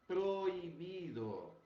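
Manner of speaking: proibido drawn out very slowly; slow teacher demo of proibido. Shown as a demonstration. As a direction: spell out proibido syllable by syllable. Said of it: "Então, vocês".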